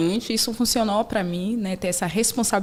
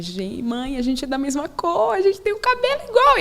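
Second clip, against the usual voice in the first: daughter's voice; 6 semitones higher than usual. Retold or imitated.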